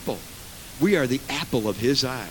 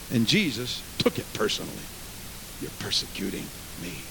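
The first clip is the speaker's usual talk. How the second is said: low, very soft solemn delivery; hushed; 7 semitones lower, 6 dB quieter than usual. Acted. Preacher showing Jesus took it personally, hushed and low. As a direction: drop almost away to land the point. Sudden hush to land point.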